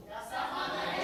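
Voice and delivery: ordinary talk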